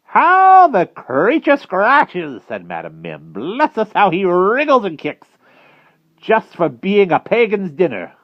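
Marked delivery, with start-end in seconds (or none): 0.0-2.4 high, loud, relishing witch; Madam Mim. A narrator's reading. 3.3-8.1 high, loud, relishing witch; Madam Mim. A narrator's reading.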